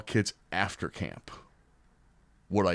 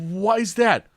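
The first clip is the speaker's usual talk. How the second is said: high, louder question; puzzled kid; 12 semitones higher, 8 dB louder than usual. Retold or imitated. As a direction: ask like a confused teen. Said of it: "He's like".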